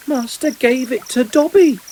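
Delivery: high squeaky elf voice; awed little elf; Dobby the house-elf. A narrator's reading. Elf repeating it to his master in awe.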